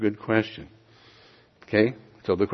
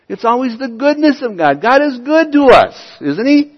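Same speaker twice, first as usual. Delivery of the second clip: much higher and louder, fervent and building; preaching at full force; 10 semitones higher, 9 dB louder than usual. Acted. Pastor rousing his congregation about God's goodness, at full preaching force. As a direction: lift the whole room with God's goodness.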